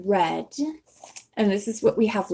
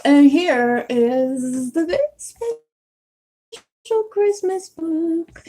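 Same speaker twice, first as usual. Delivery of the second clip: drawn-out, higher singsong announcement; grand singsong reveal; 8 semitones higher, 4 dB louder, much slower than usual. Real, not acted. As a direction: present it slowly and playfully, stretching the words. Playfully presenting the special book.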